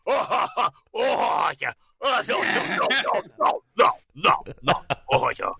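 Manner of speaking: high comic laugh-yells; strained, high goofy shouting; celebrity yell impressions. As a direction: switch between hammed-up star hollers. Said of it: "Guess who's laughing or yelling?".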